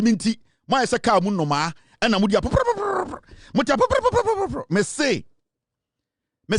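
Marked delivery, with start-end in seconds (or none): 2.0-3.2 mock bird chatter. Mocking caged birds chirping. 3.5-4.6 mock bird chatter. Mocking caged birds chirping.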